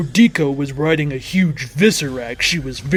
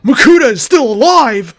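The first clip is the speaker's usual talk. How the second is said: shocked yell, much higher and louder; startled cry that a villain still lives; 9 semitones higher, 8 dB louder than usual. Acted. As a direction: shout it out in disbelief. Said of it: "I yelled".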